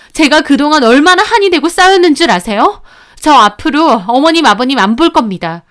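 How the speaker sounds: fed-up daughter-in-law cutting off her in-laws; indignant outburst. Acted. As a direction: let the long-held grievance burst out. Daughter-in-law's furious parting outburst.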